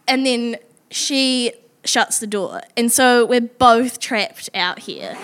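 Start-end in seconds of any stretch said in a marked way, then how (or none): none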